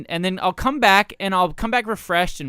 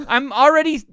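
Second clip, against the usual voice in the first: ordinary talk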